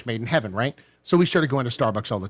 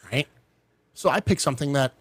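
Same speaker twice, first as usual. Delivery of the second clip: ordinary talk, nothing marked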